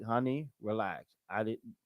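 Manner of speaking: unbothered calm voice, low and soft; calm woman. Retold or imitated. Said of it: "And she's like".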